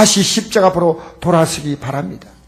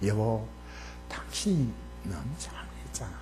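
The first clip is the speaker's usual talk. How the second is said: soft, hushed wife's voice in a quarrel; acting out a gentle couple's fight, barely voiced; quiet wife; 9 semitones lower, 20 dB quieter than usual. Wife gently answering her husband, hushed and soft. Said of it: "목소리 적으면요".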